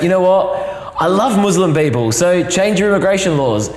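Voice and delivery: God popping down in an English voice; chirpy mock English accent. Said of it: "God might be English".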